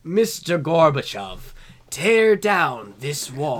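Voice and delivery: slow, loud, measured speechmaking; Ronald Reagan impression. Correcting co-host's take, slow loud presidential oratory. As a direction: deliver it slowly to a crowd. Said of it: "doesn't sound like Ronald Reagan".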